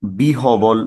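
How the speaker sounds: বিহবল with h and b sounded, bi-ho-bol; spelling reading, bi-ho-bol; mistaken pronunciation. Shown as a demonstration. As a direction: say বিহবল slowly as three syllables, bi-ho-bol. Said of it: "কেউ কেউ আমরা এটাকে".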